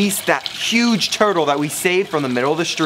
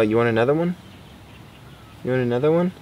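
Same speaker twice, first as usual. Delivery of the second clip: ordinary talk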